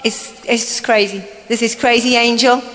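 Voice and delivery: ordinary delivery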